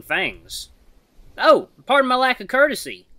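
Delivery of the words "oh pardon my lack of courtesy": put-on unconvincing Australian accent, high and loud; attempted Australian accent. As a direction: have a shaky go at an Australian accent. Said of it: "don't think that was Australian sounding".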